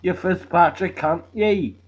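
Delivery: usual speaking voice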